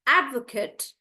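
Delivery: "advocate" stressed on AD; "advocate" with a short, reduced ending; noun pronunciation. Shown as a demonstration. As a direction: say "advocate" as "AD-vuh-kut". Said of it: "I will pronounce them as".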